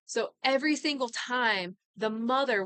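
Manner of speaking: ordinary delivery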